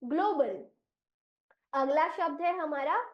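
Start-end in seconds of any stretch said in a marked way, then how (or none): 0.0-0.6 ग्लोबल said clearly as glo-bal; teacher modelling ग्लोबल; model pronunciation. Shown as a demonstration. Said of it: "इस शब्द का उच्चारण हम करेंगे".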